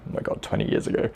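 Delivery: fast breathy whisper to himself; quick private whisper of amazement; whispered aside. Real, not acted. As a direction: whisper it in disbelief. Disbelief at how long ago.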